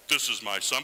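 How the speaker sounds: lightly voiced divine proclamation. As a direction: say it as God should sound, firmly. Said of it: "I think it should be more like".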